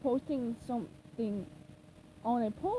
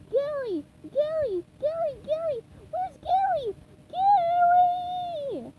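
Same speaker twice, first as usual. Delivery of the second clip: panicked calls, higher and louder to a long final wail; frantic calling; 8 dB louder, much slower than usual. Acted. SpongeBob desperate to find his snail, frantic rising shouts. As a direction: yell the name in rising panic. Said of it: "frantically searched".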